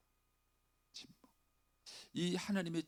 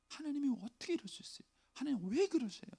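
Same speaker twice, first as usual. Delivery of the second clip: much higher, indignant cry; complaining to God; 13 semitones higher than usual. Acted. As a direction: protest to God in disbelief. Voicing believers' complaint. (하나님께 따지듯 높은 목소리로)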